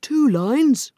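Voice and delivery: high, loud Mock Turtle cry; excited cry. A narrator's reading. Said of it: "cried the Mock Turtle".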